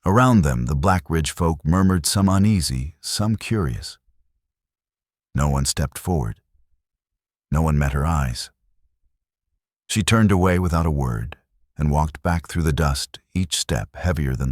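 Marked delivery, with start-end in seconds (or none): none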